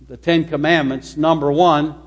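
normal talking voice

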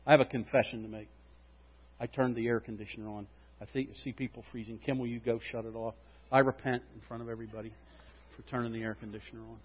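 low, soft confessional aside to the congregation; quiet aside. Real, not acted. Pastor confessing to the church, soft and sheepish. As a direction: drop the voice, chatty and sheepish.

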